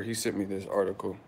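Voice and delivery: ordinary talk, nothing marked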